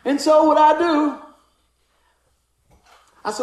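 ordinary talk

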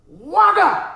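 high, loud "waka" whack noise; imitated whack. Preacher acting out a kick to the shin, loud imitated whack. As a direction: sound out the blow, loud and high.

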